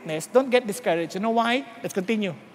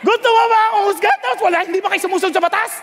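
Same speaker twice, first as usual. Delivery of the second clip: fast, higher, indignant outburst; accusing retort; 6 semitones higher, faster than usual. Acted. Paul accusing the council of hypocrisy, heated and fast. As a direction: fire it back at the judges, rushing. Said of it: "Parang ganun ang dating".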